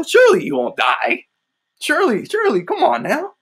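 high, strange sly tempter voice; Satan mocking. Preacher voicing Satan offering the fruit, high sly mocking voice. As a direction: coax them like a grinning devil. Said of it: "He's like".

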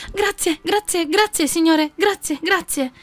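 very high, gushing grateful thanks; effusive thanks. Acted. Poor woman thanking the sage while bowing. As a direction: thank him again and again, overjoyed.

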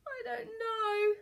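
high-pitched pirate wail; blubbering pirate voice; tearful blustering. A narrator's reading. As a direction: wail it out helplessly. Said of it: "blustered Captain Stubble".

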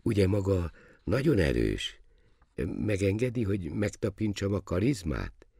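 put-on high, reverent young prince; politely awed, ceremonious request; almost unctuous. A narrator's reading. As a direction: ask solemnly in a high, gentle voice. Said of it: "kérdezte szinte kenetteljesen".

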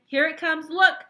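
high, loud, building call; excited cry. A narrator's reading. Wonka as the picture arrives, excited cry. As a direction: build to a shouted last word. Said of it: "he cried".

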